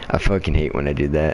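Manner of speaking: usual speaking voice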